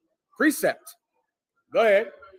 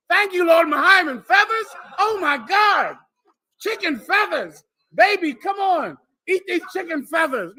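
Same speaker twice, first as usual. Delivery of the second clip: high, hammed-up delight; mock overjoyed thanks; 10 semitones higher, 4 dB louder than usual. Poor man calling baby to eat feathers, high mock joy. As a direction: cry out in mock gratitude. Acts out being given chicken feathers.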